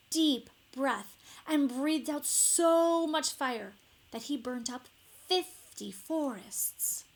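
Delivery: ordinary delivery, nothing marked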